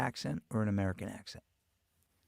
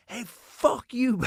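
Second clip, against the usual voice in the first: high, drawn-out Long Island road-rage yell; retold rage in a thick Long Island accent; guttural Long Island accent; 11 semitones higher, 5 dB louder, much slower than usual. Irate driver to an English guy, guttural Long Island accent. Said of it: "it was so guttural Long Island".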